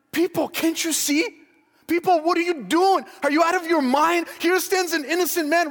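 high, loud pleading cry; exasperated plea to the crowd. Pretend. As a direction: shake the crowd awake, disbelieving. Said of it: "you would say, like".

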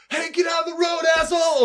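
high shouted insult at a driver; shouted road rage. Retold or imitated. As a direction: yell it out the car window. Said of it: "saying, like, whole things like".

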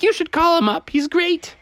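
high, keen recommending; enthusiastic mommy. Acted. Mommy recommends her pediatrician to neighbors, enthusiastic. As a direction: push it brightly in a high voice. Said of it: "And she's like".

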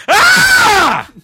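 loud, very high yell of fright; acted-out shriek of panic; startled scream. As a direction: scream as if jolted awake. Woken again by shadow shaking him.

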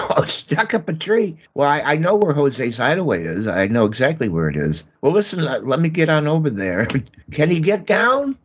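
tickled and eager to help; amused excitement. Acted. Delighted call from old friend.

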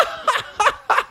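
very high gloating chuckle; high mocking laugh. Stinky laughs.

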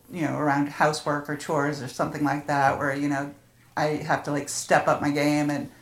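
normal talking voice

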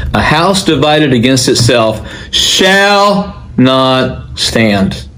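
slow declaration with SHALL stretched long; stress on SHALL. Retold or imitated. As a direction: lean hard on "shall", then pause before "not" and "stand". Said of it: "he emphasized the word shall".

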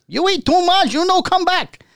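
put-on high-pitched owner in a Chinese accent. Owner quote, much higher.

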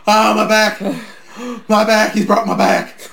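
hammed-up wrestler yelling about his back; mock pain cries. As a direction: ham up the agony like a wrestler selling a hit. Voicing Flair selling pain.

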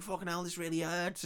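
high, soft mock complaint; retold pain, small and high; mock pained vampire. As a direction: lightly act out a vampire moaning about pain.

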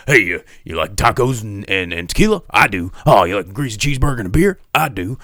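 loud, fast hammed-up Momoa banter; Jason Momoa impression. Podcaster mocking Momoa's silly lines, loud and hammed up. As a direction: ham up a loud party-guy Momoa. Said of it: "saying ridiculous things like".

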